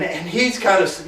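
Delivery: ordinary talk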